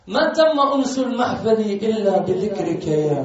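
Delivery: loud slow recital of the line; loud recited verse. Real, not acted. The preacher tries the poem line loudly for the hall. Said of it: "أنت صوتك أقوى صوت".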